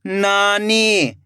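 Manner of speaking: नानी joined as one slow word; blended word. Shown as a demonstration. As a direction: read नानी whole, falling at the end. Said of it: "खींच के पढ़ना है".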